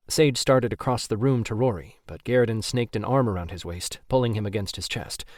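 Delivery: plain reading voice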